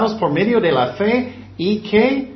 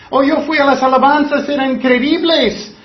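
higher, louder gushing mock voice; mocking gushing worshipper; 7 semitones higher, 5 dB louder than usual. Preacher to congregation, parodying an emotional worshipper, high and gushing. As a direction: gush over the worship like it proves faith.

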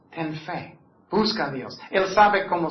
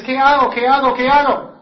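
higher, louder frantic mock panic; panicked asking; 7 semitones higher, 8 dB louder than usual. Preacher imitates a frantic parent asking what to do. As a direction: repeat it in rising alarm. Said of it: "Y tú dices".

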